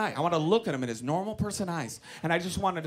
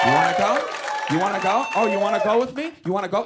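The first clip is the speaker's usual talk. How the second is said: loud, repeated tough-guy challenge; Mark Wahlberg; 5 dB louder, faster than usual. Acted. Comic daring the other driver to fight, loud tough-guy act. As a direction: push each question out as a dare. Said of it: "I wanted to go full Wahlberg".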